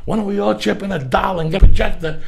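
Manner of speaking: high-pitched comic indignation; retold rant. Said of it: "And I went off".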